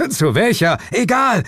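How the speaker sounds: high, loud, hurried question; frantic shouting. A narrator's reading. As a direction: shout back in the heat of a chase. Shouted exchange during the chase.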